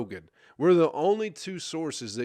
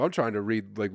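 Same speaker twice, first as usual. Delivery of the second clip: normal talking voice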